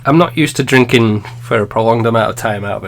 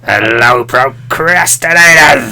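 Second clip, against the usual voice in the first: Nerdcubed impression; much slower than usual. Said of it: "That's close".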